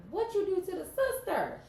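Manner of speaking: very high, loud disbelief; shocked outburst. Real, not acted. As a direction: cry out in shock.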